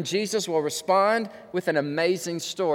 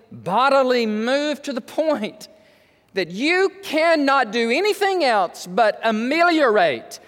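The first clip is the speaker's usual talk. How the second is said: high, fervent sermon delivery; emphatic, impassioned pulpit voice; fervent preaching; 6 semitones higher than usual. Real, not acted. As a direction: preach it with stretched key words. Preaches at full force.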